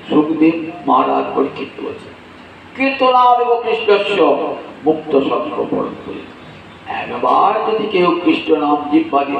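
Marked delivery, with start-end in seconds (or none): 2.8-6.2 higher, chanted Sanskrit verse; scripture chant; Sanskrit recitation. Acted. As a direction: intone the shloka like scripture.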